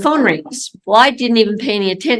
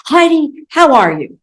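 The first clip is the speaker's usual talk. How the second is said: President Monson's voice; 8 semitones higher, 6 dB louder than usual. Retold or imitated. Church president greets nervous mission president's wife, imitated high pulpit voice. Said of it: "you can hear that voice even today".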